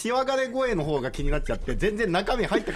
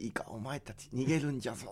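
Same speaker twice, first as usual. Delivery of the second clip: put-on low, much softer old-man voice; old man impression; 5 semitones lower, 10 dB quieter than usual. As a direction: quietly warn like an old grandfather. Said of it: "北の国からの".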